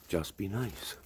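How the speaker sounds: soft, cool, unbothered bouncer line; Patrick Swayze quote. Retold or imitated. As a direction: say it quietly and calmly like a laid-back tough guy. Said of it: "what Patrick Swayze said in Roadhouse".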